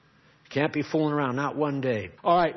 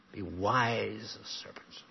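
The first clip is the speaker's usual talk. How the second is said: hushed whisper, lower; whispered scripture; 4 semitones lower, 7 dB quieter than usual. Acted. Preacher to congregation, whispering the scripture line. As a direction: breathe the verse out like a secret.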